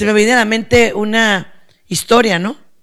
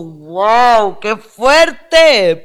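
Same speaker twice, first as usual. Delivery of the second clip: drawn-out, louder awe; amazed reaction; 5 dB louder, much slower than usual. Retold or imitated. Speaker reacting to the story's hard truth, emphatically amazed. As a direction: let the wow swell into emphatic astonishment.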